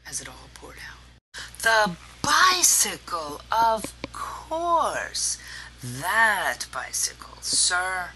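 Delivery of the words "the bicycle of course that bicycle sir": loud, high, drawn-out exclamation; grand proclamation. A narrator's reading. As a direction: proclaim it with grand, slow relish. Boy launches into boastful tall tale.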